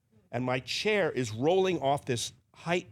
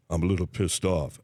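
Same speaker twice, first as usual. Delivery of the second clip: much lower, deadpan big-man voice; low Shaq voice; 7 semitones lower than usual. Retold or imitated. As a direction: drop the voice deep like Shaq. Shaq after losing.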